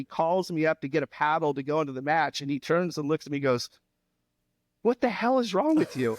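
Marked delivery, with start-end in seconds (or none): 4.8-6.2 high, startled coach's question; a coach caught off guard, half-shouting; retelling the coach's shocked question, lightly played.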